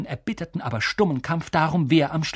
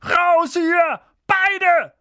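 high, loud croak; croaked order; 6 dB louder, much slower than usual. A narrator's reading. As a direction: croak out a furious command. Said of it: "krächzte Onkel Vernon".

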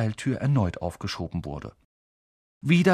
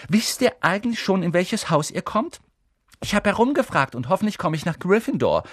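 put-on high, eager know-it-all girl; Hermine, bossy chatter; 9 semitones higher, 3 dB louder than usual. A narrator's reading. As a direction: chatter on, briskly taking charge.

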